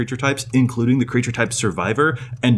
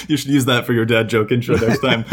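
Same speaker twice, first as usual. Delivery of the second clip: ordinary delivery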